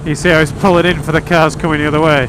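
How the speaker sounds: ordinary delivery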